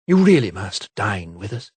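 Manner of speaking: lightly flirtatious invitation; coquettish. A narrator's reading. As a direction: coax him, sweet and flirty. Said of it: "waving her fan coquettishly".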